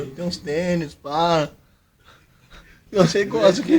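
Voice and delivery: normal talking voice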